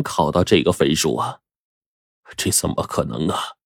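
low, soft muttered disbelief; quiet disbelief. Acted. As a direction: mutter it to yourself, stunned. 徐总看到分数难以置信. (低声嘀咕，难以置信)

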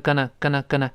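gonna said quick and light; reduced gonna. Shown as a demonstration. Teacher showing the fast reduced form. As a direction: run going to together into gonna. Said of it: "读得更快一点呢".

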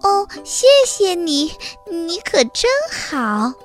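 high, delighted mouse voice; pleased, high-pitched storybook gratitude; happy thanks. A narrator's reading. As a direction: thank a friend with joy. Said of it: "高兴地说".

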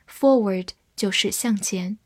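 ordinary delivery

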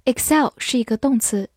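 ordinary talk, nothing marked

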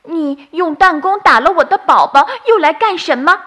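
a mother bird defending her chick; angry. Acted. Mother bird confronting Doudou, angry and loud. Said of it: "生气地说".

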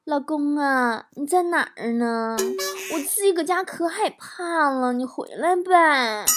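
drawn-out, cooing wheedle; coaxing wife. Acted. Wife phones husband to come home, coaxing. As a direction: play a needy wife, stretching the phrase endings.

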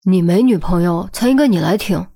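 ordinary delivery, nothing marked

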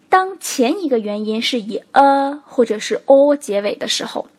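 ordinary talk

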